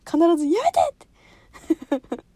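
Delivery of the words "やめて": loud, high sharp shout; mother's yell. Retold or imitated. Mother shouting at the daughter to stop. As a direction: cut her off loudly. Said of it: "って言われる".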